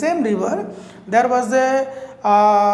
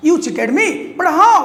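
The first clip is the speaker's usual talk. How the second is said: much higher, louder disbelief; stunned protest; 6 semitones higher, 5 dB louder than usual. Acted. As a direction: cry out in shocked disbelief. Crocodile realizes the trick.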